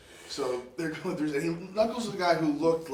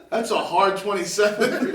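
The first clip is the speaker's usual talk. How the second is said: loud teasing jab, laughing; loud laughing retort; 4 semitones higher, 11 dB louder than usual. Retold or imitated. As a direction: laugh through the put-down. Joey's dry retort, retold.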